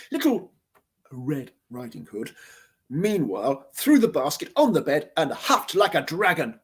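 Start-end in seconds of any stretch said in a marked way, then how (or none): none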